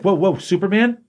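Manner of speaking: a hint of Batman hurrying to halt a charge; alarmed Batman.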